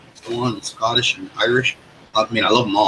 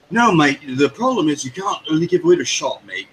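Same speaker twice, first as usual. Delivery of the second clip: put-on English accent; 5 semitones higher than usual. Friend imitating Colt after his rehab, English accent. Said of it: "learned an English accent".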